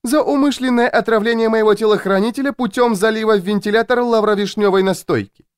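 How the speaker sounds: high, insistent woman's voice; accusing Louisa. A narrator's reading. Louisa accuses her captive of poisoning, insistent. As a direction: reel off the charge on a sustained high pitch. Louisa improvises a criminal charge.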